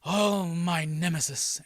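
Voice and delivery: slow, drawn-out mock supervillain; hammed-up villain addressing his nemesis; villain monologue. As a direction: savor it slowly like a comic-book villain. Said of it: "so then there's this monologue".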